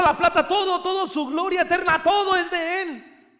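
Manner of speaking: loud, high preaching to the congregation; full-force preaching. Acted. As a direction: proclaim it with full fervour. Preaching climax.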